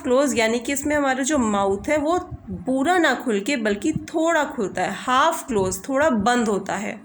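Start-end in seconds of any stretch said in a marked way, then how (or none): none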